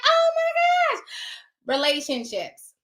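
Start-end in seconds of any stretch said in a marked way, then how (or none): 0.0-1.0 amazed. Retold or imitated. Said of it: "I'm like".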